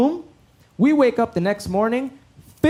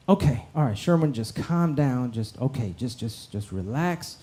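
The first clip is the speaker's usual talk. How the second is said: low, hushed, soothing voice, softer; calming a friend; 9 semitones lower, 8 dB quieter than usual. Retold or imitated. Calming panicking friend Phil, low and hushed. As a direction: talk him down gently and quietly.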